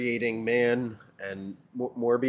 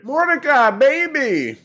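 high, loud quoted shout; movie quote shout; 13 semitones higher, 9 dB louder, slower than usual. Retold or imitated. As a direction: belt out the movie line. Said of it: "that's a Cabin in the Woods reference".

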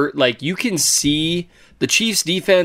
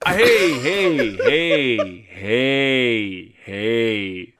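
each hey lower than the last; stepping down; 7 semitones lower, much slower than usual. Pretend. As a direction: sink lower with every hey. Drugged-sounding voice, falling hey.

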